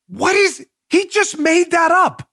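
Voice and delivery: high, drawn-out indignation; worked-up and high-pitched; exasperated disbelief. Real, not acted. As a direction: call out the falsehood, incredulous. Exasperated at the article's claim.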